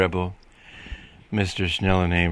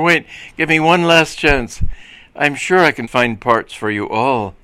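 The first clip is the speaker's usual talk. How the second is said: loud, raised plea; urgent cry; 5 semitones higher, 5 dB louder than usual. A narrator's reading. Schnellenhamer begging the policemen for a last chance, urgently. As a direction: plead loudly for one more chance. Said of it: "cried Mister Schnellenhamer".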